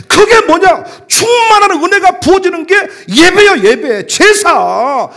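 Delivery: loud, high preacher's shout; fiery preaching. Acted. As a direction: proclaim it to the whole congregation. Preaching at full force.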